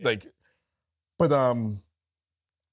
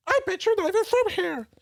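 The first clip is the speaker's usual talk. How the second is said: put-on drunk regular's high voice. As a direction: squeak it out like the drunk patron. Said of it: "he starts talking like this".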